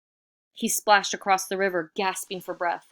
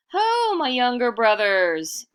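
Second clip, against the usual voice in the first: slow, loud, higher hail; calling out; 8 semitones higher, 9 dB louder, slower than usual. Acted. Iktomi hails the bats at night, calling loudly. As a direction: call out across the dark. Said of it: "Iktomi called out to the bats".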